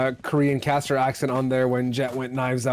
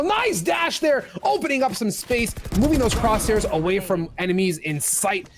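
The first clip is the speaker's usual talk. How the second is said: excited play-by-play; 7 semitones higher than usual. Acted. Hyped call of a dash.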